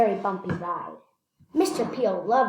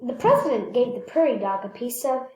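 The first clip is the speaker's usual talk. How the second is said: ordinary delivery, nothing marked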